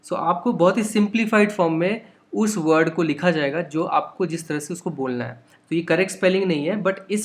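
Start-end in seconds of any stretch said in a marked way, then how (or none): none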